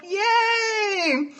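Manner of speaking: high, drawn-out happy cheer; real excitement, held long and high; excited cheer. Real, not acted. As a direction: let out a delighted, high-pitched cheer. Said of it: "I'm excited to see her".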